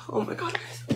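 ordinary talk

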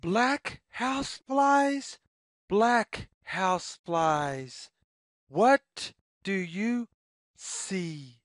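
usual speaking voice

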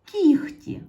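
"кіхті" said high and loud; emphatic teacher "кіхті"; loud model word. Shown as a demonstration. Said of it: "Пишемо".

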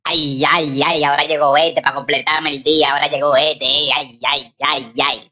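low, soft groaning complaint; self-pitying low moan; mock lament. Dismayed that another visitor arrives.

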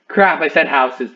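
loud, high alarmed outburst; panicked yell. Real, not acted. Realizes he gave away location.

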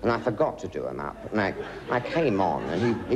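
normal talking voice

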